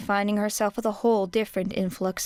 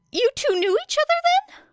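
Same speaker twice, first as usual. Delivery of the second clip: put-on high, worried boy's voice; anxious Midoriya; 5 dB louder than usual. Midoriya checking on Era, high and worried. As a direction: ask with nervous concern, pitched up.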